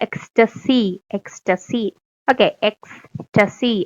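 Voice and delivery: ordinary talk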